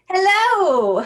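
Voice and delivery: usual speaking voice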